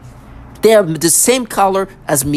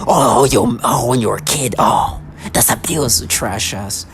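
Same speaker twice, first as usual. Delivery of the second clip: mocking taunt; 6 semitones lower, slower than usual.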